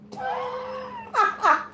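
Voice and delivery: high-pitched squealing laugh; high cackle. Real, not acted. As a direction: break into a high, gleeful cackle. Reader laughs at the message.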